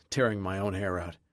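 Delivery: ordinary delivery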